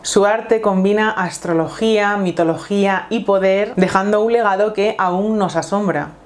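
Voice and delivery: epic voiceover opening a film; nature documentary. Acted. Dramatic intro over music.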